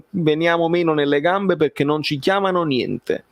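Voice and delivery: normal talking voice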